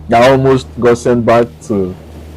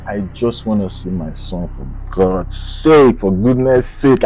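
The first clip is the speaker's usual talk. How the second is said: desperate plea; 5 semitones lower, 4 dB quieter than usual. Retold or imitated. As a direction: beg quietly, drawing it out. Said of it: "I can't remember exactly how I said it".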